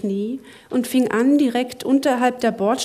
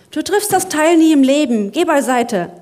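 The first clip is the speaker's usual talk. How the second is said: urgent; 6 semitones higher, 7 dB louder than usual. Acted. Zack takes over in panic.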